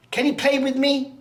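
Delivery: put-on high, loud child asking to play; little girl. Little girl runs up to a stranger on the train, high pleading child voice. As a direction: pipe up like an eager little girl.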